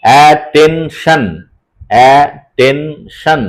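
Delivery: "অ্যাটেনশন" very slowly as a, ten, shan; syllable split. Shown as a demonstration. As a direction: dictate "অ্যাটেনশন" syllable by syllable, twice. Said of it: "এইভাবে উচ্চারণ করে".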